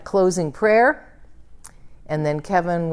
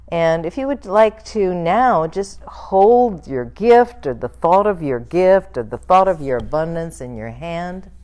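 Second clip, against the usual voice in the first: guided blessing; 3 semitones higher, 4 dB louder than usual. Acted. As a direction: lift the gift up in a gentle blessing.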